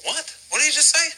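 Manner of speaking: high, startled disbelief; surprised crowd. Retold or imitated. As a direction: react as if you can't believe your ears. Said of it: "everybody says".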